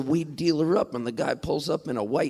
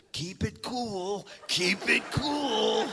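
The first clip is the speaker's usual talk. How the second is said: put-on high, soft, slow little-old-lady voice; slow high croon, like a conspirator at the door; tiny frail mom; 8 semitones higher, 5 dB quieter, much slower than usual. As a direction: imitate a tiny mom, drawing out each word. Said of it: "she opens the door and she goes".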